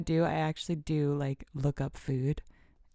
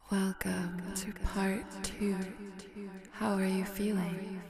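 echoing announcement; 2 semitones higher, 7 dB quieter than usual. Pretend. Podcaster welcoming listeners to part two, echoing and soft.

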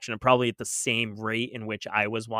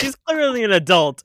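mock indignant thought; 10 semitones higher, 10 dB louder than usual. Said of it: "You're like".

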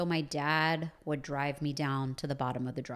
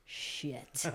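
whispered dread; 2 semitones lower, 9 dB quieter than usual. Retold or imitated. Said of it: "I'm like".

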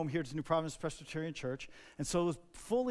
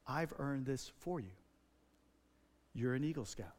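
tender; 4 semitones lower, 5 dB quieter than usual. Retold or imitated. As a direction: say it gently like a gift. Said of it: "in a very tender way".

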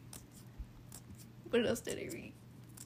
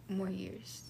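barely voiced, much softer; quiet trailing-off; 3 semitones lower, 9 dB quieter than usual. Real, not acted. Answering how fifteen feels, quietly trailing off. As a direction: let it fade almost to a whisper. First take, misheard by the friend.

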